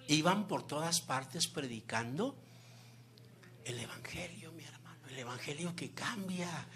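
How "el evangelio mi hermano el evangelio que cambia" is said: whisper. Real, not acted. Intimate emphasis in sermon.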